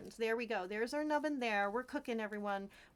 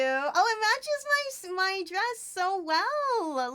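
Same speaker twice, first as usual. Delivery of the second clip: delighted; 7 semitones higher, 6 dB louder, much slower than usual. Real, not acted. Delighted by the hat gift.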